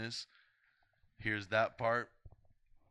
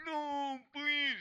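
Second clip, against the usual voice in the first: high-pitched loud mock pleading; hammed-up begging; pleading victim; 5 dB louder than usual. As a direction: squeal it like a panicked victim. Acting a movie victim pleading.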